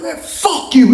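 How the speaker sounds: high, heated comeback; mock quarrel at a high pitch; retold angry retort. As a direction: fling the curse back, acting it out. Retold exchange of curses.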